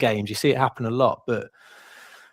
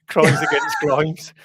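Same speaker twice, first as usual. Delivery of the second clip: laughing; 5 semitones higher, 6 dB louder, slower than usual. Retold or imitated. Quoting Frank, laughs heard.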